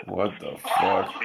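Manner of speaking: ordinary talk, nothing marked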